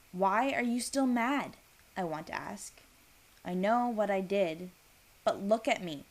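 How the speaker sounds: ordinary delivery, nothing marked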